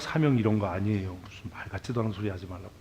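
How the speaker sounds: usual speaking voice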